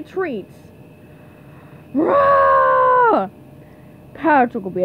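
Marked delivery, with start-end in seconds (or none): 1.9-3.3 high, drawn-out war cry; battle roar. Acted. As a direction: roar yourself into action. Contestant roaring before his turn.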